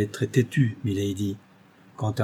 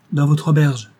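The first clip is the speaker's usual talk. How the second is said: sharp, louder outcry; Lady Audley startled, calling out louder; exclaiming; 4 semitones higher, 5 dB louder than usual. A narrator's reading. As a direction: cry it out in alarm. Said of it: "s'écria-t-elle".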